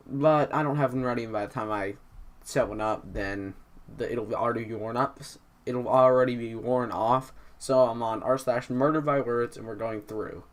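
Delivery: normal talking voice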